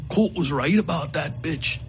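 bitter outlaw. Acted. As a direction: spit out the grudge. Coakley's bitter rant.

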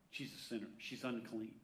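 hushed, low mutter of disapproval; judgemental inner thought. Acted. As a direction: say it low, as if to yourself. Voices the Pharisee's inner thought.

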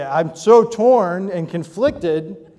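dramatic indecision over lunch; overplayed inner conflict; mock torn. Shown as a demonstration. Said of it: "And you say".

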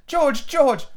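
high-pitched pleading, fully voiced; urgent wife. A narrator's reading. Wife pleads with angry husband, urgent. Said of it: "Missus Darling whispered".

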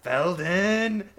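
high, loud call across the cockpit; pilot shouting a name. Acted. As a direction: yell his name to get attention. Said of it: "the pilot's like".

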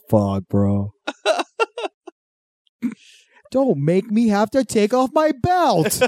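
slow, high mock threat; Erica threatening. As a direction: warn him about the belt. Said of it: "Erica's like".

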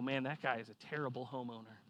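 low, soft, quick mock sneer; softly voiced neighbour's disdain; imagined judgement. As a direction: mutter what the neighbours might say. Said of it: "People are gonna look at this and go".

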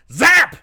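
loud high zap; zap sound effect. Retold or imitated. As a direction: blurt the zap like a laser blast. Narrating a laser firing.

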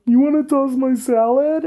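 high-pitched incredulous question; retold amused disbelief. Retelling their amused reply to a partner, high and incredulous. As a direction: repeat it back high, as if tickled. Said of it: "I started laughing and then I'm like".

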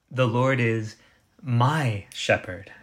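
slow phrase, weight on "my"; stress on "my". Shown as a demonstration. Preacher showing how personal this is, stress on "my". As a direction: set "my" apart and draw it out.

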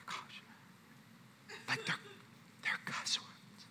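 hushed, hesitant confession; imitated nervous whisper, halting; timid whisper. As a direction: whisper it as if it's scandalous. Timidly confronting her about swearing.

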